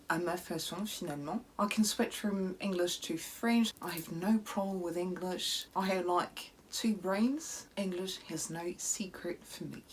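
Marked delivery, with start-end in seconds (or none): 1.6-7.7 anglais à l'accent britannique, fluide; English accent. Shown as a demonstration. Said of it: "je prononce les marques et les noms des produits de façon britannique". 7.8-9.8 chuchoté en anglais, malicieux; souffle-le en anglais comme un secret; whisper. Pretend.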